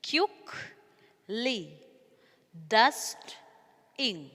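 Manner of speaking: each word cut in two with a pause, like "out... side"; "quickly" as quick... lee, "dad, D" as two beats; syllable by syllable. Shown as a demonstration. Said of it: "this how we can split these words".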